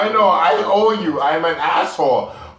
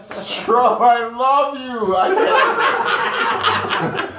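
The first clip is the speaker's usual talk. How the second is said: laughing; 5 semitones higher, much slower than usual. Real, not acted. Excited shout-out while laughing.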